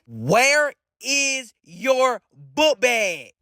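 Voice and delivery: very slow, word-by-word interrogation; slow, deliberate questioning. Acted. Suspicious cousin asking again about the book bag, slow and deliberate. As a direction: draw out each word with pauses between.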